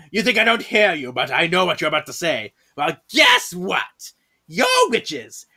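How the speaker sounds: hammed-up indignant gnome lecture; angry gnome teacher. Monkeyshine lectures his class, hammed-up angry gnome. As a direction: scold the class with swooping, punched emphasis. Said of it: "Very angry gnome over here".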